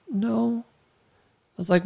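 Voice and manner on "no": put-on higher, softer child's no; little girl's no. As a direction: answer like a small daughter. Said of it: "And she went".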